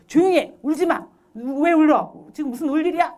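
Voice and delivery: acted stern scolding. Parent to a crying child, scolding. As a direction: order the crying stopped. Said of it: "감정을 억압하기가 쉬운".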